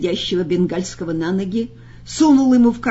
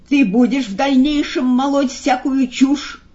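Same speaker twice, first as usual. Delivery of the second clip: higher, threatening interrogation; stern, raised-pitch scolding; menacing; 6 semitones higher, 3 dB louder than usual. A narrator's reading. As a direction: demand an answer threateningly. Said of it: "грозно спросил фаготу плачущей головы".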